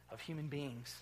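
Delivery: normal talking voice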